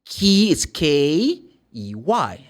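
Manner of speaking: K E Y spelled slowly; slow spelling. Shown as a demonstration. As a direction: spell it out carefully. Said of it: "key is different from K".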